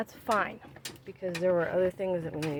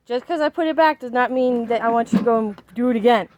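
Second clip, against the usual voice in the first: scolding; 3 semitones higher, 9 dB louder than usual. Real, not acted. Mom scolding kids at home.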